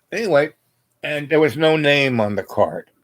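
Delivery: ordinary talk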